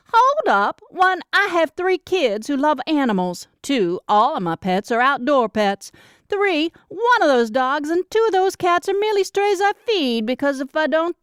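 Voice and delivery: high, bright-eyed protesting sister voice; younger sister, indignant. Acted. Younger sister fires back at her teasing sibling, high and indignant. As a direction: set the record straight, pitched well up.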